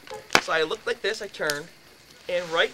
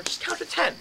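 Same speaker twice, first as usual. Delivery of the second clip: higher, patient grandmother voice; grandmother's advice; 12 semitones higher than usual. Retold or imitated. Grandmother calming a tantrum, gently higher. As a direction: lightly voice grandma calming the child. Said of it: "my grandmother said to her".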